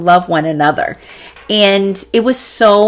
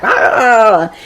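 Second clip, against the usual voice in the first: werewolf transformation cry; 3 semitones higher than usual. Retold or imitated. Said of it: "transforming into the werewolf".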